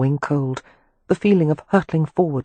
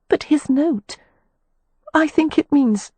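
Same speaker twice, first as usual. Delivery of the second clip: put-on anxious young woman; 12 semitones higher, 4 dB louder than usual. A narrator's reading. Poppy voiced high.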